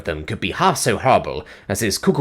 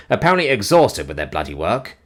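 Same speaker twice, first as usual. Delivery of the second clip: ordinary delivery, nothing marked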